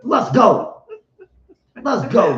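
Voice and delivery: laughing, loud let's go; laughing hype cheer for Deion; hyped cheer. Real, not acted.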